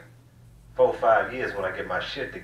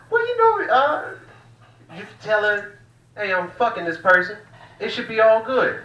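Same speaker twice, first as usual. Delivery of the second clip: much higher, louder mock friend; 10 semitones higher, 4 dB louder than usual. Comic mocking his friend's polyamory advice, high and loud. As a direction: ham up the naive friend explaining. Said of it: "He was like".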